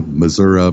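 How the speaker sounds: "Missouri" with an "uh" ending; local pronunciation. Shown as a demonstration. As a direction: end "Missouri" on a schwa, "Muh-ZUR-uh". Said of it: "It's not Missouri".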